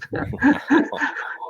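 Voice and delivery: a high, breathy burst of laughter. Real, not acted. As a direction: laugh off the punchline. Punchline of the success story.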